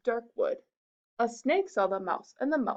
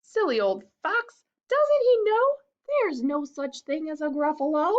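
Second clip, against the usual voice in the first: high, louder giggly mouse; smug mouse; 6 semitones higher, 5 dB louder than usual. Acted. Mouse laughs at the fled fox, smug. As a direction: gloat like a cheeky mouse.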